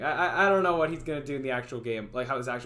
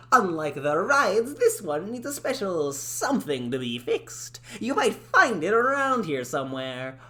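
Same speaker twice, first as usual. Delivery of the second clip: higher, brighter character voice; cheery cartoon shopkeeper voice; 4 semitones higher than usual. Game character tells the player about the balloon cart, cheerful. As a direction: sell it like a bubbly game guide. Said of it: "doing a voice a like a this".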